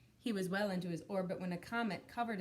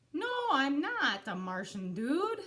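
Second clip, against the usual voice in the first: loud, high, huffy; indignant retort; 6 semitones higher, 7 dB louder than usual. Acted. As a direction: snap back offended. Indignant reply.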